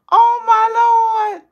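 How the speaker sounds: high, loud, slow exclamation; overwhelmed. Real, not acted. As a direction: gasp it out overwhelmed.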